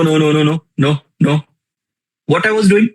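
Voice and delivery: ordinary talk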